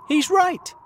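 very high, loud exclamation; excited cry. A narrator's reading. Creatures agree that land is below, excited cry. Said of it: "they cried".

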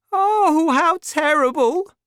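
high, loud shocked woman; distressed cry. A narrator's reading. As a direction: cry out in dismay. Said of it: "she cried".

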